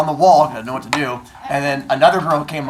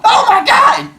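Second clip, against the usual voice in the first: very high, loud OMG scream; shocked scream; 9 dB louder, slower than usual. Shown as a demonstration. Teacher showing how you'd react to Athena. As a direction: scream in pure terror. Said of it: "it was not like".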